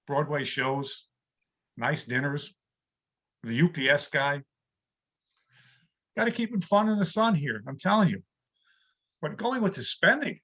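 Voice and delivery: normal talking voice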